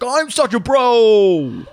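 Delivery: high, slow, loud bro. Acted. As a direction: drawl it out like a proud bro. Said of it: "'cause he's like".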